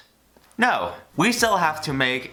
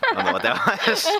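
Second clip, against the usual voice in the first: high laughing words; laughing through panic; nervous laugh; 10 semitones higher than usual. Real, not acted. Bugs tangled on her finger.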